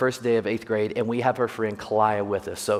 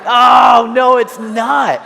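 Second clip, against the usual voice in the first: much higher, louder, slow mock outcry; mock shout; 12 semitones higher, 13 dB louder, much slower than usual. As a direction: protest loudly and drawn out. His retort to wife.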